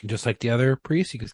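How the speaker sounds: usual speaking voice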